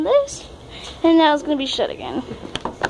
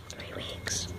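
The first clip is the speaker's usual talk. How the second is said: hushed whisper, almost no voice; barely audible whispered repeat; 17 dB quieter than usual. Real, not acted. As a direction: whisper it for emphasis.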